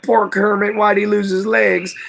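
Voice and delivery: put-on a childlike pang of pity for the frog; pitying thought. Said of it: "I was like".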